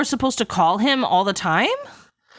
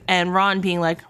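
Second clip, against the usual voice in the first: ordinary talk, nothing marked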